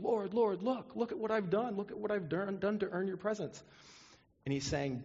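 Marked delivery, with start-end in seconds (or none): 0.0-3.6 high-pitched, eager plea; pleading self-justifier. Acted. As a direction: plead your case in a higher, pressing voice. Voicing boasters pleading their works.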